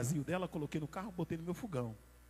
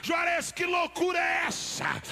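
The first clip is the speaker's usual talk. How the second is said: building from chanting to loud, high shouting; fervent chanted sermon climax; preaching at full force; 7 semitones higher, 4 dB louder than usual. Acted. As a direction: whip the crowd up with each count.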